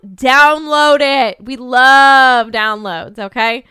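loud, slow, emphatic call to listeners; loud plea. Real, not acted. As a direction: belt it out to the audience. Host's outro plea to listeners.

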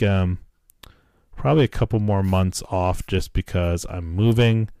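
ordinary talk

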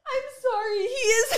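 high, helpless laughter through the words; laughing hard. Real, not acted. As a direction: laugh so hard it nearly turns to tears. Laughing at the story.

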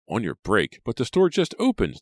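high, loud outraged protest; man exasperated with rude clerk; indignant. Acted. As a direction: push back hard in disbelief. Customer's outrage grows.

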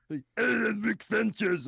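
loud, gargly, off-target Rick voice; a cosplayer's croaky attempt at Rick; mock bad impression. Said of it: "Rick Sanchez if he was trying to gargle a frog".

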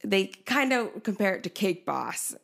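ordinary delivery

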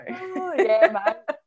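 put-on jokey Aussie accent on mate; mock Aussie accent. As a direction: play up the Aussie accent as a joke. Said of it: "can't understand the Aussie accents".

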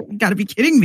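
can't believe what you're hearing; mock outraged disbelief; incredulous. Reacting to unjust jailing.

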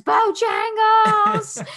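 high, loud, drawn-out shout; kids yelling. Retold or imitated. Kids yelling the safe word at fighting parents in front of friends, long high shout. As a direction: stretch it out like a kid hollering across the room. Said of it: "literally yelling at us from".

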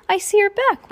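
very high, loud character voice; worker's jeering greeting. Acted. Unfriendly worker voiced in story.

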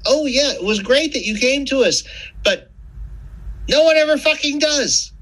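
high sarcastic doctor voice; mock doctor. Patient mimicking doctors scolding, high sarcastic voice. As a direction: play the smug doctor scolding.

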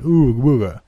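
hammed-up spooky moan; mock the story spookily.

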